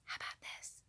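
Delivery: unvoiced whisper; whisper under pillow. A narrator's reading. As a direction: breathe it out, barely audible. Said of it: "Mouse said from under his pillow".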